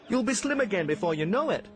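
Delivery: high, boyish cartoon train voice; chirpy little train apologising; Stevie the steam train.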